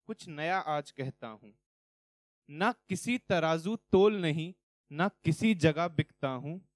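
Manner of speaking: measured, rhythmic verse delivery; poem recitation. Acted. Recites own poem on stage.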